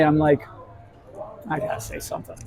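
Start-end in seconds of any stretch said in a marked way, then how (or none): 1.5-2.4 very soft, low, almost voiceless; said under the breath to himself; murmured inner thought. Retold or imitated. Storyteller thinking he must speak up, murmured.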